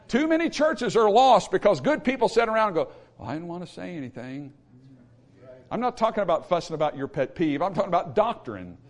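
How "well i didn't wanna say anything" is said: much softer, reluctant voice; mock-timid, hushed excuse; meek mumbling bystander. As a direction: back away from it quietly. Acting timid bystanders who keep silent.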